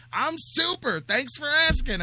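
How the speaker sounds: a sing-song mock of the cheery answer; high mock Jeff. Radio host mocking his guest's cheerful greeting, high and sing-song. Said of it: "You go".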